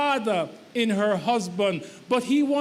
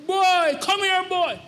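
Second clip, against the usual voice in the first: put-on higher and louder order, BOY punched; bossy boy call; 8 semitones higher, 3 dB louder than usual. Wife mimicking what husband heard, bossy order. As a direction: shout it like a mother ordering her boy. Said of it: "But you heard".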